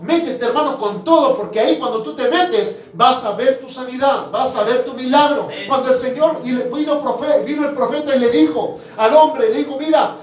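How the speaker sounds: preaching. Acted. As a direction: preach with conviction, hammering each promise. Preaching to the congregation. (predicación con fervor, más fuerte y rítmica)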